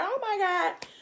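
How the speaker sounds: very high "oh my God" squeal; shocked squeal. Retold or imitated. Girlfriend hearing the marriage proposal, high surprised squeal. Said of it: "She's like".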